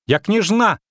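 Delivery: put-on high, loud girlish squeal; indignant little princess. A narrator's reading. Little princess to the witcher, outraged squeal. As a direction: insist in a high, outraged child's voice.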